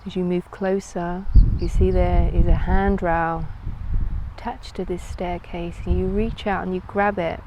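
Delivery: calm, unhurried guided meditation voice. Acted. Yoga teacher leading listeners into a floating visualisation, calm meditative delivery. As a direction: guide them inward with long pauses between phrases.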